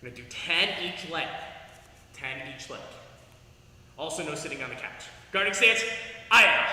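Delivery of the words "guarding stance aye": martial arts shout. Real, not acted. Martial arts class call.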